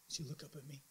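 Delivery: usual speaking voice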